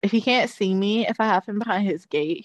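ordinary delivery

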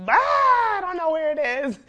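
high, loud, flustered friend voice; panicked friend. Retold or imitated. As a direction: play the friend panicking at the wheel.

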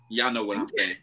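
usual speaking voice

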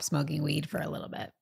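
hushed confession; whispered. Real, not acted. Admitting she'll quit weed, hushed whisper. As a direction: drop to a whisper.

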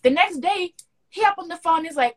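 usual speaking voice

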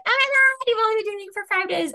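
high shocked gasp, then fast; gossiping friends, shocked and fast; scandalized friends. Retold or imitated. Friends scandalized by quick romance.

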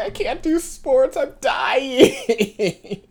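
high, slow mock wail; hammed-up teenage misery; retold melodramatic complaint. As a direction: whine it as if at death's door. Retelling dramatic younger self.

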